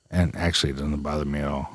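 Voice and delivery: put-on low, soft grumpy old man; old geezer voice. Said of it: "why I'm trying to sound like an out, old geezer".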